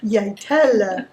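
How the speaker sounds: repeating Jeg teller slowly, yai TEL-leh; slow careful Jeg teller; Norwegian pronunciation. Shown as a demonstration. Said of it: "Very good".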